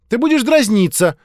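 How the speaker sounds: put-on high, loud little girl; accusing child. A narrator's reading. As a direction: accuse him like an indignant kid. Child accuses older boy.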